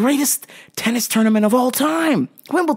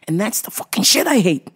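normal talking voice

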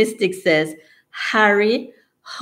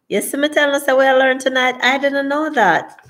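high-pitched surprised reaction; 8 semitones higher than usual. Real, not acted. Host reacts to T-shirt fact for viewers, surprised. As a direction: react to news to you, pitch jumping up.

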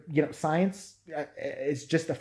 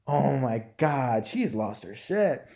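ordinary talk, nothing marked

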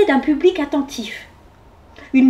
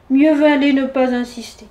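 plain reading voice

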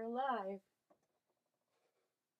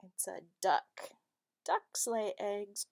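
ordinary talk

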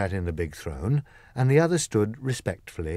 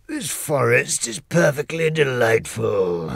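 slow, different-toned lion in delight; admiring the forest; Lion savoring the view slowly; joyful Lion; 4 semitones higher, 4 dB louder, slower than usual. Acted. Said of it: "looking around him with joy".

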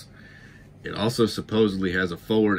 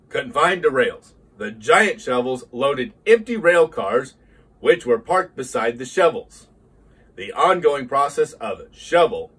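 higher, louder storytelling narrator voice; documentary narration; 6 semitones higher, 6 dB louder than usual. Acted. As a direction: tell it like a history documentary. Documentary history narration opener.

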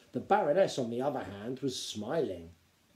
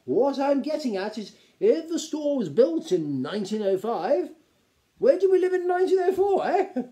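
high, louder, nervous reasoning; anxious lord working up to his point; hesitant lord; 13 semitones higher, 8 dB louder than usual. A narrator's reading. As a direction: build to a squeaky question. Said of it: "said one of the lesser lords hesitantly".

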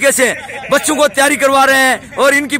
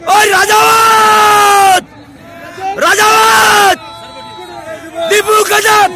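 shouted cheer; 6 semitones higher, much slower than usual. Real, not acted. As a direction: holler the name long across the ground. Coach calling out to trainees. (ज़ोर से लंबी पुकार)

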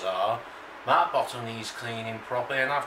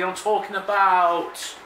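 usual speaking voice

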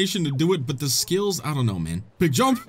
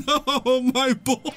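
laughing; 10 semitones higher than usual. Real, not acted. Laughing at Binkus being hit.

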